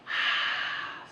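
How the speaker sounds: long breathy H sound, no voice; breathy H exhale. Shown as a demonstration. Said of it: "you make a breathy H".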